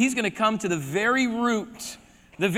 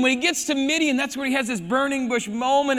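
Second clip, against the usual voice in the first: ordinary talk, nothing marked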